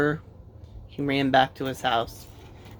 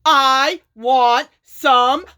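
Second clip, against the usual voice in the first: very slow, loud, higher demand; angry shout; 8 semitones higher, 7 dB louder, much slower than usual. A narrator's reading. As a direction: bellow at the grinder. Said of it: "he shouted".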